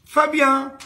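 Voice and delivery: put-on high, loud child answering; second triplet answering the teacher; little boy. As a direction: pipe up your name like a small kid. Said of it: "tu t'appelles comment?".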